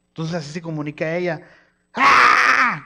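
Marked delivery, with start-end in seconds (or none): 1.9-2.8 put-on high, loud toddler shriek; baby scream. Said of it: "empieza a gritar". (grito agudo de bebé)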